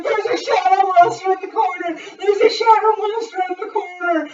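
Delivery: high, loud mock panic; hammed-up shrieked warning; imitated screaming. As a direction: act out a girl screaming in the night. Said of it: "the girl keeps screaming".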